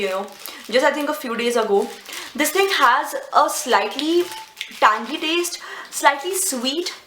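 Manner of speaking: ordinary talk, nothing marked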